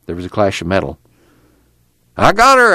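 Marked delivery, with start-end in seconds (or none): 2.2-2.7 high, triumphant call; excited high-pitched shout of success. A narrator's reading. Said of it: "Al called".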